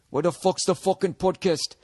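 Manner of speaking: put-on rough Scottish accent. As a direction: imitate a Scot with a shaky grasp of the accent. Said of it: "kinda do in a Scottish accent".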